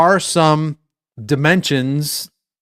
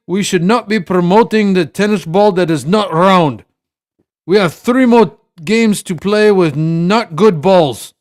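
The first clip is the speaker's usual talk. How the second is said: bad Russian accent; 4 semitones higher than usual. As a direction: play Medvedev ranting in a cheesy Russian accent. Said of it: "And that was my best, worst Russian accent".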